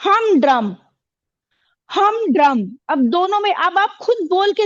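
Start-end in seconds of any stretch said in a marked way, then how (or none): 0.0-2.8 हम drum slow, equal weight on हम and drum; both syllables stressed. Shown as a demonstration. Teacher shows a spondee, stressing both हम and drum. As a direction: press on both halves of हम drum. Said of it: "दो stress syllable एक साथ होते".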